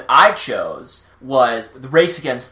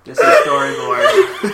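put-on squeaky kid voice; 5 dB louder, slower than usual.

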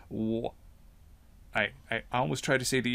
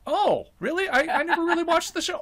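higher, lightly surprised voice; imitating surprised acquaintance; 4 dB louder than usual. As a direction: play the clueless friend, pitched up. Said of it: "I say".

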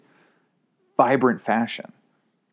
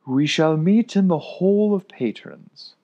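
slow, louder and higher proclamation; solemn decree; 7 semitones higher, 4 dB louder, slower than usual. Acted. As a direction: proclaim it like a ruling.

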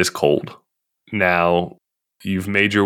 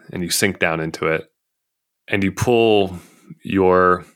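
sleep story narrator. Acted. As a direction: lull them gently toward sleep.

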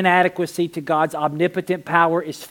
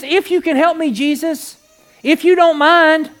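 raised, high-pitched plea; pleading; 10 semitones higher, 6 dB louder than usual. Retold or imitated. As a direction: plead loudly, ending slow and louder. Voicing a hesitant believer's plea.

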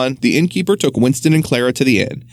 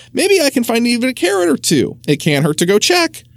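farmer's wife; 10 semitones higher than usual. Acted. Farmer's wife covering her slip.

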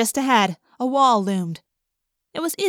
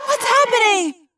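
high, loud alarmed cry; frightened cry; 9 semitones higher, 4 dB louder than usual. Acted. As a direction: cry out in panic. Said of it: "Cassie cried".

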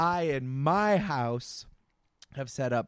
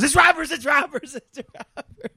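high loud hurried yell; dad shouting; 13 semitones higher, 9 dB louder than usual. Retold or imitated. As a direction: shout it to cover up. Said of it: "my dad goes".

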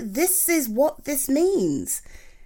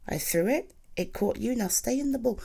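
normal talking voice

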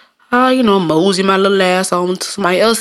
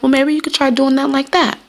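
gentle suggesting tone; 7 semitones higher than usual. Retold or imitated. Offering a coworker advice in a high gentle tone. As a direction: ease the advice in sweetly. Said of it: "I try to do it in that tone".

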